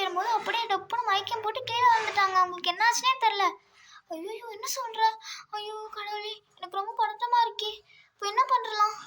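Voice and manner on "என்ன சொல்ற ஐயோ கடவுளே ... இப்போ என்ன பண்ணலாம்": normal talking voice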